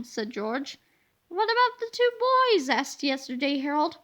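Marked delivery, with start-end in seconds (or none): 1.3-2.7 put-on curious kid. A narrator's reading. Harold asks about names.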